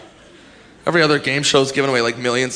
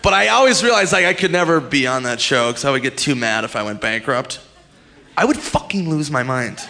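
ordinary delivery

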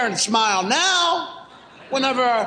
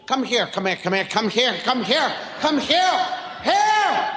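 barrage of calls; 3 semitones lower than usual. Acted. Parent calling a child who won't listen, escalating barrage. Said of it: "send a barrage of heres".

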